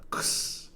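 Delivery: "Ks" as bare voiceless k-s, no vowel; just the consonants of "Ks", softly hissed; isolated consonant cluster. Shown as a demonstration. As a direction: sound out "Ks" alone. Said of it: "this final consonant cluster".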